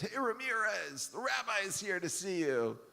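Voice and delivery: high, called-out guard voice; guard calling out. Retold or imitated. Guard calling a sleeping inmate through the bars. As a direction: rouse a sleeping inmate from outside the cell.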